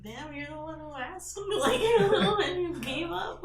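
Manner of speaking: high, loud comeback breaking into laughter; amused mock indignation. Woman mocking men who insult her after asking, laughing indignation.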